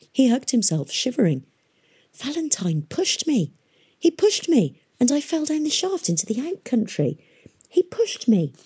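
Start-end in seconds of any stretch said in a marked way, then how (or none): 2.1-8.5 put-on high-pitched, stunned boy; shocked disbelief. A narrator's reading. Shivering boy realises he was pushed, shocked. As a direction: raise the pitch as betrayal sinks in.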